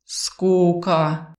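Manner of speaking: Arvil stretching the word knowingly; lingering, knowing delivery; understanding, drawn-out. A narrator's reading. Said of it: "понимающе протянул Арвиль".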